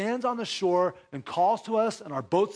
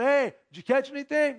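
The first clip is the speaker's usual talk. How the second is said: mild mock shout; 6 semitones higher than usual. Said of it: "shouting out".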